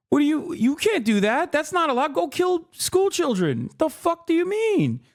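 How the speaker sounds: high, alarmed mocking voice of the powerful; high mock outrage on behalf of the rich; mock panicked elite. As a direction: squeal like the outraged establishment.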